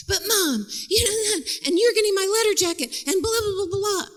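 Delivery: put-on high-pitched whiny teen protest; hammed-up teenager griping at Mom; arguing teenage daughter. Daughter picking a fight with her mother, whiny. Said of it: "She starts to get into it with Terry about some teenager thing".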